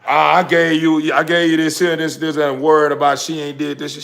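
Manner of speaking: hammed-up complaining partner; nagging score-keeper. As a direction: mimic a woman listing what she gave you. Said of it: "taking no score saying".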